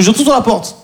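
usual speaking voice

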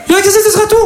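very high sing-song question; mock baker. Comedian playing the baker repeating her phrase. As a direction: chirp the stock phrase up high. Baker voiced after long order.

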